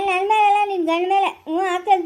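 normal talking voice

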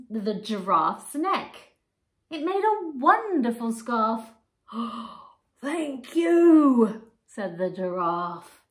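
lively storybook reading; reading to children. A narrator's reading. Teacher reading picture book to children, animated. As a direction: bring the pages alive for little ones.

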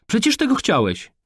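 very high-pitched comeback; shrieky teenage retort; heated retort. A narrator's reading. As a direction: throw it back in his face. Daughter retorts in quarrel.

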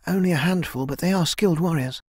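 put-on earnest young heroine. Kai tells elder about the few allies, earnest.

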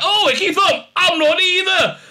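hammed-up shocked confession; mock exclaiming doctor. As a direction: blurt it out like an exasperated doctor. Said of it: "the doctor will be like".

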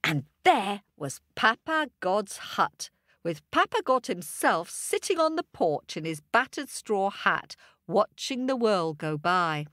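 usual speaking voice

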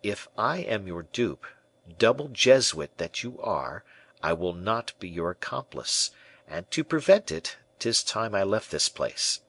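plain reading voice

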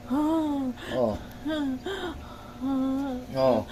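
healer's spell fading into murmurs; muttered incantation. Real, not acted.

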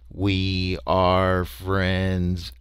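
very slow, drawn-out alien drone; droning creature. A narrator's reading. Barrel people greeting the lost explorers. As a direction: drone it like a machine.

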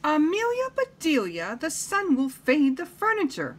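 high-pitched storybook scolding; high, fretful employer; dismayed mistress. Acted. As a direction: chide the maid in a high, alarmed voice.

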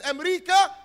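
loud, high welcoming call; eager greeter hailing the Americans. Retold or imitated. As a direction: announce America loudly like a greeter.

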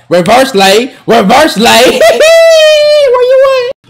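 high, loud, drawn-out laughing scream; screaming celebration. Real, not acted. Gamer hitting a reverse layup, long laughing scream. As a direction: scream it out in triumph.

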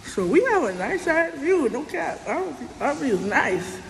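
thick-tongued speech; swollen tongue. Real, not acted. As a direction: talk around a swollen tongue. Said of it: "if y'all wondering why I'm talking like this, my tongue is swollen".

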